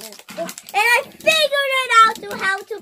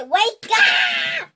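wake-up shout; 12 semitones higher, 6 dB louder than usual. Acted. Waking the toys again.

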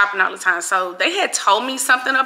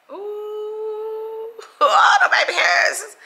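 real squeal of delight, far above usual pitch; excited squeal; 11 semitones higher than usual. Real, not acted. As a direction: coo and squeal over it, stretched high. Gushing over own baby hairs.

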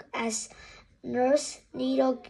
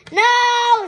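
high, loud panicked scream; 10 semitones higher, 13 dB louder than usual. A narrator's reading. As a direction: scream it in terror. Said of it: "screamed Harry".

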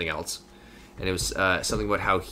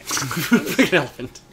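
laughing echo; 6 semitones higher, 6 dB louder, slower than usual. Real, not acted.

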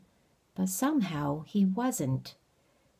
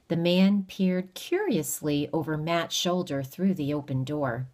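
usual speaking voice

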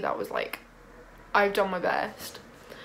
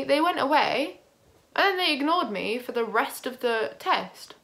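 normal talking voice

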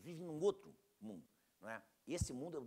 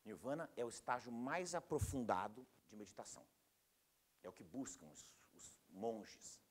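normal talking voice

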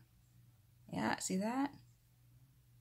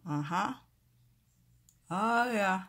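talking while fitting aligners; fingers in mouth; 5 semitones higher, 8 dB louder than usual. Real, not acted. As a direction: lips pulled by fingers. Seen 2: Fingers inside mouth pushing trays onto teeth throughout the words.

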